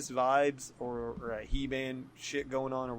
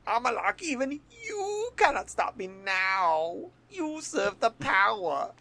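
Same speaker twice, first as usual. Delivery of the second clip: slow, high-pitched, hammed-up villain; imagined villain voice; 10 semitones higher, 4 dB louder, slower than usual. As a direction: draw out the threat like a cartoon villain. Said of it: "What if that was how he talked?".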